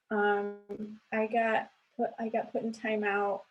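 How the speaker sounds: lightly voiced three-year-old admitting it; toddler voice. Mom imitating her toddler confessing the timeout, high and hesitant.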